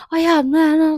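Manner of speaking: high, loud, jumping in mid-sentence; eager interrupting. Retold or imitated. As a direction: cut in eagerly over them. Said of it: "kalau kalian kayak". (menyela dengan bersemangat, nada tinggi dan keras)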